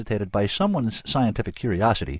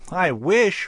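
fervent wish, high and louder; 11 semitones higher, 5 dB louder than usual. A narrator's reading. Frank wishing it were only that, fervent. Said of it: "Frank said fervently".

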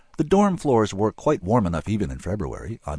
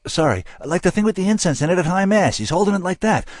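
high-pitched, agitated blurted account; Frank spilling it; 12 semitones higher, 6 dB louder than usual. A narrator's reading. Frank confessing to his interrogators what he saw, high and agitated. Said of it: "Frank just started spilling".

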